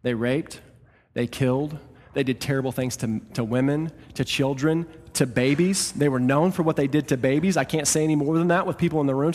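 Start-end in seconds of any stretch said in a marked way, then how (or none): none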